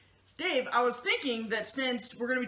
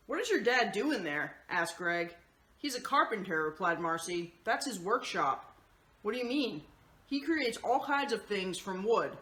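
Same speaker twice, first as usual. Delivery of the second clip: steady, lower storybook narration; reading to children; 5 semitones lower than usual. Acted. Host reading the picture book to the kids, in calm storybook narration. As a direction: read the story gently to the kids.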